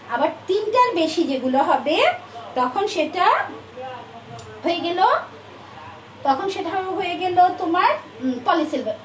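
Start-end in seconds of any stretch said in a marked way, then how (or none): none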